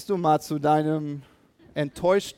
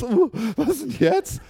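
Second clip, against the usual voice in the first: baffled; 7 semitones higher than usual. Retold or imitated. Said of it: "guckte mich ganz".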